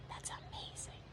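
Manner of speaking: whisper. Acted. Awed aside.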